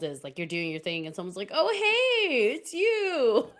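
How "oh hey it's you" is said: very high, loud mock fan greeting; excited recognition. Acting out someone recognizing the streamer, high and excited. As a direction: squeal it like a fan spotting someone.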